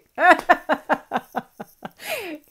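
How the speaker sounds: a high, loud burst of laughter. Real, not acted. Amused at Juan Diego, a loud high laugh. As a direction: let a real laugh out. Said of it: "I'm cracking myself up".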